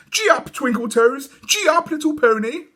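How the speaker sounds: put-on high excited child calling to her pony; high excited little girl; girlish cry. A narrator's reading. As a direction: play at riding, squealing with glee.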